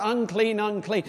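ordinary talk, nothing marked

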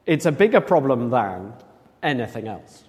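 ordinary talk, nothing marked

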